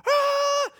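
loud, very high newborn wail; imitated baby scream. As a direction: screech like a crying baby. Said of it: "So he's screaming".

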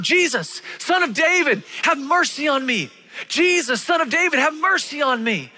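high, loud desperate call; crying out. Acted. Blind man calling to Jesus over the crowd, high and loud. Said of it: "he begins to cry out".

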